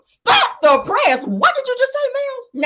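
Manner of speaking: very high, slow, stunned exclamation; mock shock. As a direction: halt everything in hammed-up disbelief. Mock shock at Melody's admission.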